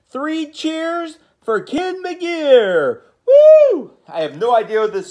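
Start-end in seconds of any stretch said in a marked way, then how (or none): 0.1-3.0 slow, high cheering call; rally the class with a cheer; hooray cheer. Acted. Cheering the book title. 3.3-3.9 loud high whoop; cheering woo. Acted. Cheer after title.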